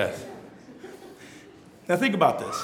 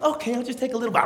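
fast, light voice of a woman giving in; 4 semitones higher, 5 dB quieter, much faster than usual. Retold or imitated. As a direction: quickly talk yourself into it.

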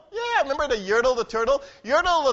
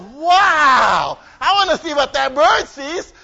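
loud, higher, eager wish; a greedy little king wanting more; eager wow; 4 semitones higher, 9 dB louder, much slower than usual. Acted. Yertle sees bird.

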